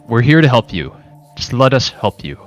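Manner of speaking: ordinary talk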